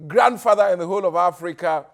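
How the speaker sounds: loud hype-man introduction; rally speech. Acted. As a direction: build the crowd up. MC hyping up the guest.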